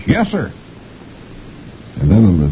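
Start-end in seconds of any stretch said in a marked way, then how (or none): 0.1-0.5 high meek cartoon private; Beetle Bailey. Beetle answers his captain, high meek private. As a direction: squeak a dutiful yes sir. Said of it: "He says".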